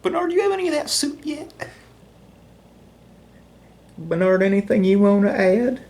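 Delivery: high, fast sing-song talk to a pet; doggy talk. Acted. Owner asks his dog Bernard about soup, high doggy talk.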